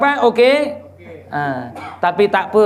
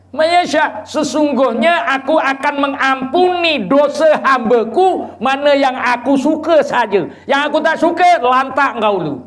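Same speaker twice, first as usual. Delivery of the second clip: raised, higher recitation and paraphrase; fervent preaching; 7 semitones higher, 4 dB louder than usual. Acted. Preacher voicing the verse to listeners, fervent preaching. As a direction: declaim the verse.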